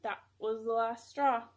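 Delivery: ordinary delivery, nothing marked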